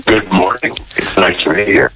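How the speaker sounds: normal talking voice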